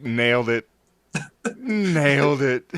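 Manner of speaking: mock self-satisfied, through laughter; smugly pleased with a bad joke; imitating a smug speaker after his joke; smug "nailed it". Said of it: "the look on his face, like".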